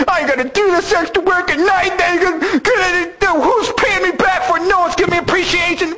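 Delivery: high, whining self-pity; whiny voice. Pretend. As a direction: whine and grumble like a sulker. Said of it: "whining about his condition".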